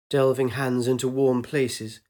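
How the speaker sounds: plain reading voice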